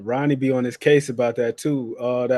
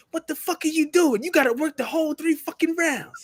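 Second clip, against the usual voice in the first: mock tirade, pitched much higher; imitating a furious yell; 13 semitones higher than usual. Coach cussing out his fighter in the corner, high mock tirade. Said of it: "He's going off on him".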